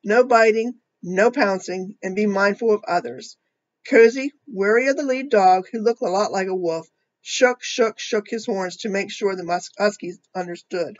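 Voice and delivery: put-on reading a picture book to children; storybook reading voice; story narration. A narrator's reading. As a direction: tell it gently to a young audience.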